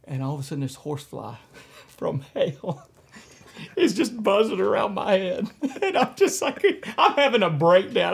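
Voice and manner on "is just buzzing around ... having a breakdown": high-pitched, loud, laughing through the words; can barely get it out for laughing; laughing hard. Real, not acted. Telling the host about the horsefly, laughing hard.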